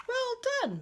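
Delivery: playful praise. Acted. Dad praising.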